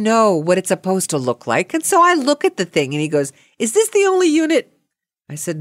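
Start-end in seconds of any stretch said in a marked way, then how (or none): 3.6-4.7 high, quick questioning call. Retold or imitated. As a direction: imitate a worker calling from another floor. Acted repairman calling from attic.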